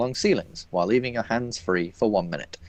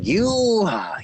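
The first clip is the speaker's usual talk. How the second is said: surprised; 10 semitones higher, 5 dB louder than usual. Acted. Shopkeeper startled by mind reading.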